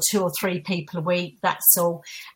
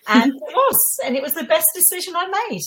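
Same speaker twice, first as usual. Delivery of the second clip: bright rising burst of joy; light up with relief and glee; excited; 9 semitones higher than usual. Real, not acted. Recalls leaving job, elated.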